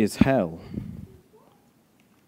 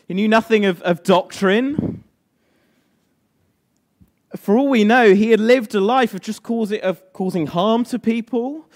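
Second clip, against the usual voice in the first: raised, loud preaching; preaching at full force; 7 semitones higher, 4 dB louder than usual. Real, not acted. Pastor urging his church, loud and high. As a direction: drive it home to the congregation.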